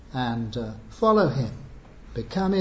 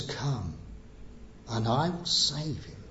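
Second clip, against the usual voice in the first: tender invitation; 3 semitones lower, 8 dB quieter than usual. Acted. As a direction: drop much softer and lower for Jesus' gentle call. Voicing Jesus' tender invitation.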